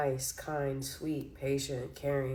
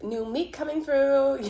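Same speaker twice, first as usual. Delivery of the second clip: a raised, loud mock announcement; mock movie quote; 7 semitones higher, 6 dB louder than usual. Tarot reader mock-quoting a movie character, raised and loud. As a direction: quote the movie line like a hall escort clearing a path.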